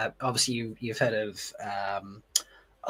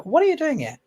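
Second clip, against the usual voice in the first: mock surprised; 14 semitones higher, 5 dB louder than usual. Acted quote of a character.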